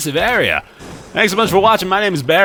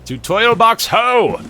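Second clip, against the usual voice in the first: announcer cry; 11 semitones higher, 6 dB louder than usual. Pretend. Announcing tutorial box.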